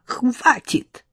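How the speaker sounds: a short, irate "enough"; angry. A narrator's reading. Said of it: "гневно изрекла мать".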